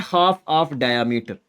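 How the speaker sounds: slow dictation. Shown as a demonstration. Dictating answer.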